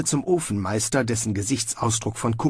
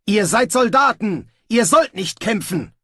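high, loud yell to stop; shouted command; 10 semitones higher, 5 dB louder than usual. Acted. Snibral stopping two soldiers fighting, loud shout. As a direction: bark it at the brawlers. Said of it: "rief er".

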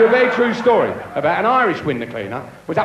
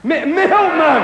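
high, slow, louder mock-amazed "milkman"; mock surprise; 7 semitones higher, 7 dB louder, much slower than usual. Real, not acted. Comedian to audience member, mock amazed. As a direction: stammer then repeat it in fake astonishment.

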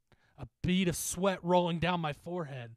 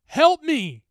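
high, desperate yell; shouted plea; 17 dB louder than usual. Acted. Bitten bully shouting to the others for help. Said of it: "he shouted at us".

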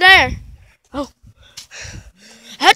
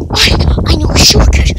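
normal talking voice

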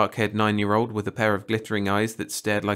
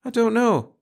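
uncertain; 14 semitones higher, 6 dB louder than usual. Acted. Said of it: "said the other little girl uncertainly".

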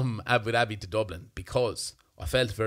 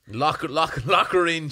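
emphatic joking; 5 semitones higher, 6 dB louder than usual. Retold or imitated. Joking about locking in fiancée.

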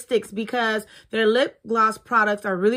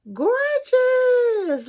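excited gush; 14 semitones higher, 4 dB louder than usual. Real, not acted. Admiring the lipstick case.

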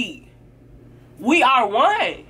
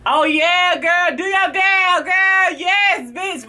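overdone fake enthusiasm, shouted high; fake cheering; 8 semitones higher, much slower than usual. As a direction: hype her up without meaning it. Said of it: "that little fake ass".

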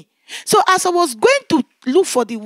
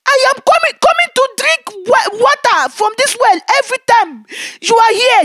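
urgent reply; 8 semitones higher, 6 dB louder than usual. Retold or imitated. Pleading answer to the spirit.

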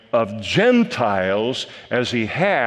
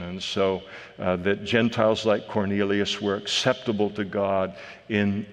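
ordinary talk

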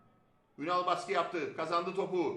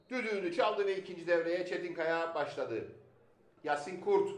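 normal talking voice

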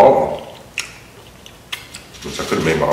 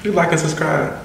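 upbeat outro; 10 semitones higher than usual. Real, not acted. Outro call to viewers.

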